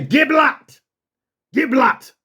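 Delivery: high, forceful order to speak; authoritative command. Shown as a demonstration. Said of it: "Say it like that. Say it with authority".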